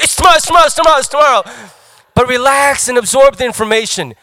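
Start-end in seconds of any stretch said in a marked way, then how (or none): 0.0-1.4 higher, louder, frantic chant; panicked cramming. Pretend. Crammer panicking at themselves the night before, frantic. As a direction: slap yourself awake in a panic. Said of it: "slapping your face going".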